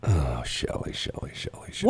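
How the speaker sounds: breathy, near-whispered sighing of her name; hushed exasperation. Acted. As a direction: sigh her name in weary disbelief. Mock despair at cohost.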